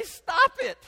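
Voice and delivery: sudden high, loud mock yell; frustrated parent yell. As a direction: snap at the screaming baby. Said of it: "And you wanna say".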